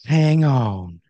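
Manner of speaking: loud in-character warning; Randy Wrench. Acted. Randy warns player before rappelling, loud drawn-out call. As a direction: call it out and stretch it. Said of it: "he looks you in the eyes and he goes".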